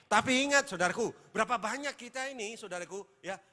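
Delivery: high, loud, preaching at full force; a pastor's climax thundering over his congregation; fiery preaching. Acted. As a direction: drive the warning home to the church.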